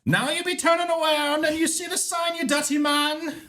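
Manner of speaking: put-on high, squeaky Jamaican old lady; thick Jamaican accent. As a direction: scold him in a shrill Jamaican lady voice. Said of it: "in a thick Jamaican accent".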